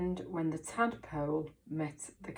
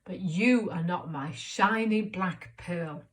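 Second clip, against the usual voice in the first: cross voice; 9 dB louder, much slower than usual. Shown as a demonstration. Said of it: "she says this in a cross voice".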